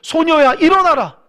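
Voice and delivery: put-on loud and high, raising the girl; a loud summons to wake the dead; commanding call. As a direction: call her up with full authority. Jesus commanding dead girl to rise.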